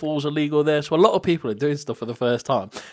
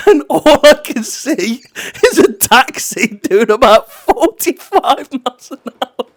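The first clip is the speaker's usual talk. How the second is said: high, slow words breaking into whispered laughter; barely voiced through helpless giggles; laughing whisper; 10 semitones higher, 8 dB louder than usual. Real, not acted. Describing the taxi to a friend, laughing whisper.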